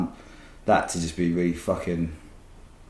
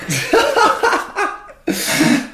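big high burst of laughter; loud laugh; 5 dB louder than usual. Real, not acted. Telling a friend about the fake park, loud laugh. Laughing at ironic break room.

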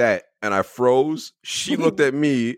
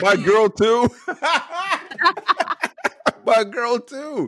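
high squealing laugh through words; 12 semitones higher, much slower than usual. Real, not acted. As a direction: crack up while saying it. Laughing agreement.

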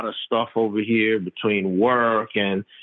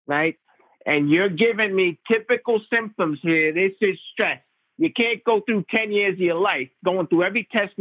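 pitched-up imitation of a blunt, know-it-all Long Island Italian doctor; 8 semitones higher than usual. Primary care doc scolding the patient about his cough, a higher, blunt imitation. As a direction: play the old family doctor, much higher, scoffing and certain. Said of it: "typical L-, Long Island Italian doctor".

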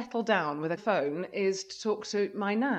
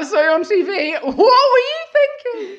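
high, loud, giggling teasing; laughing mock tease; 9 semitones higher, 10 dB louder than usual. Laughing as her friends tease her about the TV outfit. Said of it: "All my friends were like".

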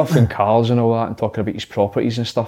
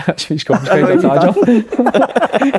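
mock foreign teammate; 7 semitones higher, slower than usual. Imitating teammate's broken English.

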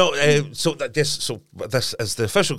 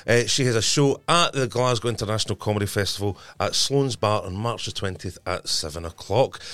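normal talking voice

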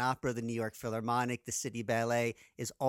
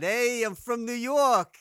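put-on loud, high, drawn-out New York accent; 10 semitones higher, 9 dB louder, much slower than usual. American guest mocking what his accent is not, exaggerated New York accent. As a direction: ham up a broad New Yorker accent. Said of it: "so it's not, ... I don't speak like that".

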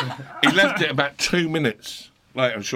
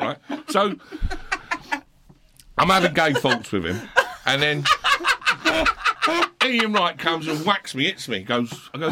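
usual speaking voice

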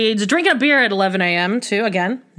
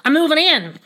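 casual parent question, pitched higher; higher dad voice; 7 semitones higher than usual. Retold or imitated. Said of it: "his voice is, like, higher".

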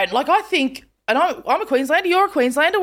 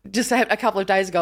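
ordinary talk, nothing marked

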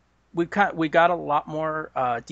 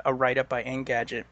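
ordinary talk

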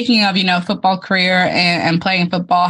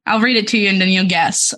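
ordinary delivery

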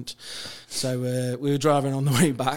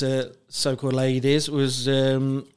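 ordinary talk, nothing marked

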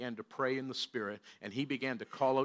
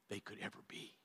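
whisper; 5 semitones lower, 17 dB quieter than usual. Real, not acted. Sermon point trailing into whisper.

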